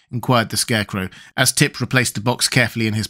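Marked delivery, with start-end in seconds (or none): none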